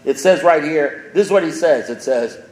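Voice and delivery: loud, high, fervent preaching; a speaker on fire at the podium; preaching at full force. Acted. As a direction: hammer the message home to the room. Continues the sermon climax.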